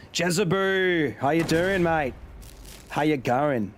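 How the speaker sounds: drawn-out, playful Australian greeting; Australian accent. Pretend. Streamer greeting a viewer in chat, put-on Australian accent. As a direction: hail a mate in broad Australian.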